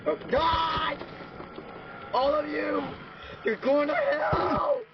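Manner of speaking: high, drawn-out shout of doom; high slow doom wail; despairing shout. Real, not acted. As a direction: wail it at the heavens. Dying in game, cursing mobs.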